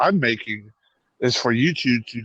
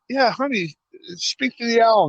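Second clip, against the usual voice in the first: higher, sweet mom voice; imitating the mom; 10 semitones higher than usual. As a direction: play the mom, pitched well up. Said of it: "He's like".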